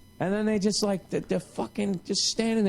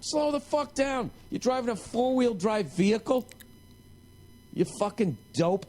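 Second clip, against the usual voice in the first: strained voice pitched up; higher yelling rant; 8 semitones higher than usual. Retold or imitated. Yelling at the TV, still pitched up. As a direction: keep ranting a bit high. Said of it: "voice cracking".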